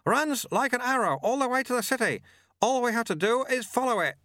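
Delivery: high-pitched call over the wind; shouted. A narrator's reading. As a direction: call out high, to carry over the rushing air. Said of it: "the sweeper shouted back".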